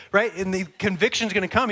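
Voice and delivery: ordinary talk, nothing marked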